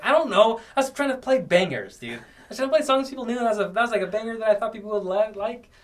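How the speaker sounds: high-pitched, hurried defence; flustered excuse. Retold or imitated. Retold excuse to teasing friend.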